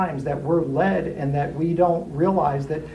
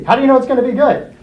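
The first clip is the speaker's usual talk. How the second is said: higher, louder doubting question; skeptical challenge; 8 semitones higher, 7 dB louder, faster than usual. Acted. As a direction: grill the optimist. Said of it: "That's the C".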